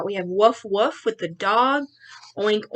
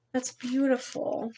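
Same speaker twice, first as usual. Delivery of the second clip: much softer tender aside; soft admiration; 3 semitones higher, 9 dB quieter, slower than usual. Real, not acted. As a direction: murmur it lovingly. Admires the rainbow.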